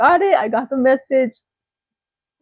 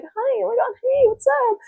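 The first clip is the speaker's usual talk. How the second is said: very high gushing hi; fake sweet greeting; 13 semitones higher than usual. Vlogger imitating women gushing over others. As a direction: coo it like a two-faced friend.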